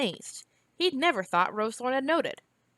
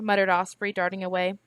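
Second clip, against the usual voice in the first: usual speaking voice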